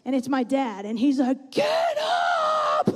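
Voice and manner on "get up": very high, drawn-out mock yell; strained high-pitched imitation shout; imitated dad yelling. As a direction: holler it like a dad from the sidelines. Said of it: "he's, like, yelling at me".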